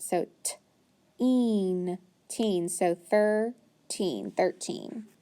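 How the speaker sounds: put-on t-een stretched slow, then teen, then thirteen; segment, then blend the whole word; teacher blending thirteen; sounding out. Shown as a demonstration. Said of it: "second syllable".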